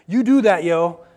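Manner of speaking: loud, offhand "do that, yo"; flippant mock order. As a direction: toss it off like a careless boss. Jesus mock-preaching without living it.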